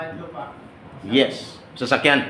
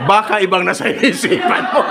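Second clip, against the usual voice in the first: higher, laughing tease; teasing laugh; 9 semitones higher than usual. Real, not acted. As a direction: tease him while laughing. Teasing the audience member. (nanunuksong may tawa)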